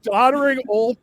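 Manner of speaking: loud, swearing political rant; furious outburst to his co-hosts; angry rant. Real, not acted. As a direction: vent at full volume about Biden. Ranting about the election.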